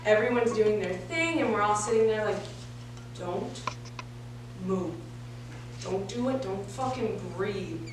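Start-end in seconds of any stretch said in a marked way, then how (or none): 3.2-7.8 low, soft, frozen with dread; hushed warning to stay still; tense hush. Acted. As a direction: barely let the words out, pausing between each. Frozen while police search the car.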